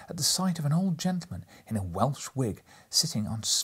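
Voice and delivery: plain reading voice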